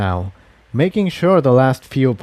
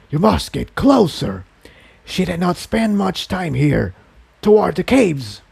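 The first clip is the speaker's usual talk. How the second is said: inner voice; 6 semitones higher than usual. A narrator's reading. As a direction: urge him on like a hidden presence. The inner voice directs.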